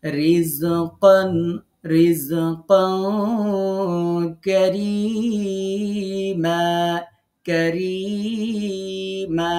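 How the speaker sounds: very slow chant, light "r" in রিজকান and কারীমা, long-held "ee"; thin ra. Shown as a demonstration. Teacher showing ra needs no effort, slow chanted thin "r" in কারীমা. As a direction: recite রিজকান কারীমা with unrounded lips, stretching the vowels. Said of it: "এমনি এ বারিক হয়ে বের হবে".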